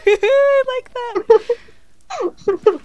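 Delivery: laughing through the words; words squeezed out mid-laugh. Real, not acted. Laughs at a chat joke.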